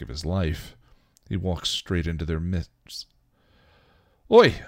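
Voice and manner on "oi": sharp, much higher, louder shout of "Oi!"; shouted to a camp of knights to get attention; loud call. Acted. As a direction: bark it out to grab a camp's attention. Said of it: "he announced loudly".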